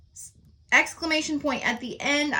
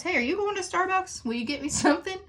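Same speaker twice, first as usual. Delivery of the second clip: high, light friendly question to a colleague; chirpy high-pitched coffee request to a coworker; casual asking a favor; 8 semitones higher, faster than usual. Retold or imitated. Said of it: "So I could ask Mister Cox".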